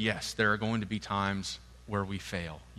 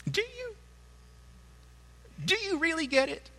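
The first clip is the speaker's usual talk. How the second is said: very high mock-sweet question; gently mock their certainty; high sing-song doubt; teasing doubt. Real, not acted. Preacher's teasing rhetorical question.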